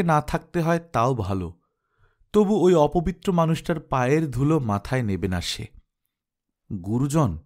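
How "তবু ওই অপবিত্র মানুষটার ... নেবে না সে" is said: plain reading voice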